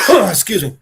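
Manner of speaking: ordinary delivery, nothing marked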